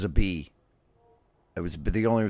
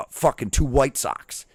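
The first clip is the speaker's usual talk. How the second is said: ordinary talk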